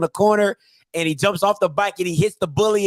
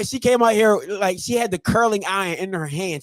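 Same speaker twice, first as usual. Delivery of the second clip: normal talking voice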